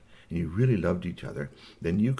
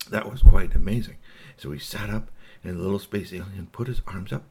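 ordinary delivery, nothing marked

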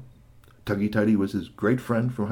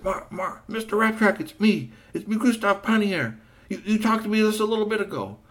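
much higher, pleading, ends in a yell; frantic plea; 11 semitones higher, 4 dB louder than usual. Acted. GP phoning Marvel from the tree, frantic and high. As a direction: beg for rescue, rising to a cry.

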